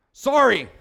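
a quick, loud, offhand sorry; curt sorry. Retold or imitated. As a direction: toss off the apology without meaning it. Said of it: "Say it like you mean it".

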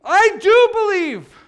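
high, loud, drawn-out cry of faith; passionate scream. Acted. Preacher acts the desperate father exclaiming, passionate scream. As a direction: scream it out slowly with full passion. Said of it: "think I'm really screaming today".

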